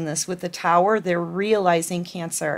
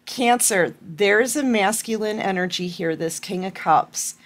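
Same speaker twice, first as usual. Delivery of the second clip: ordinary talk, nothing marked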